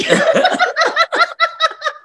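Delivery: high squealing laugh; giddy squeal of a laugh. Real, not acted. Fan hearing that a star will call, with a high squealing laugh. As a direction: let out a high delighted squeal.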